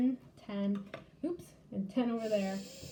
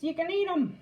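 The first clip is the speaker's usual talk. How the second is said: excited; 7 semitones higher, 7 dB louder than usual. Real, not acted. Ending with chocolate chips treat.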